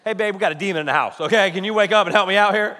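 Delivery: rapid-fire pretend plea to wife; hurried, insistent mock wake-up call; mock urgent plea. Imagined plea to sleeping wife.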